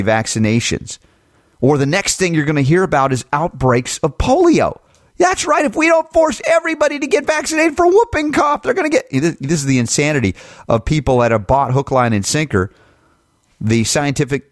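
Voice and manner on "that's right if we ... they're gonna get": high, sneering parody voice; much higher, mocking imitation; mocking the commenter. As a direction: ham up the panicked vaccine fan.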